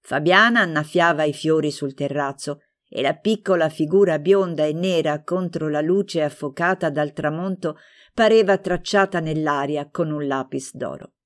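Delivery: plain reading voice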